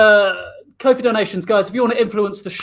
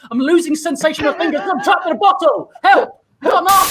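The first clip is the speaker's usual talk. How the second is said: shouting for help; 8 semitones higher than usual. Acted. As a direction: yell for help, rising in panic. Trapped dad calling for help.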